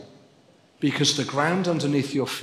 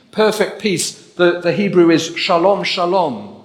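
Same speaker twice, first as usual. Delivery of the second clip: higher, louder, building preacher's cry; fervent preaching; 4 semitones higher, 6 dB louder than usual. Real, not acted. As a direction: preach it out to the congregation with fire. Rising to full preaching force.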